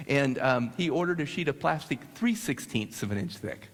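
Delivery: ordinary talk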